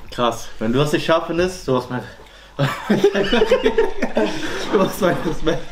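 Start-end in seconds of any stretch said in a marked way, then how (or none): 2.6-4.7 burst of laughter. Real, not acted. Laughs mid-sentence.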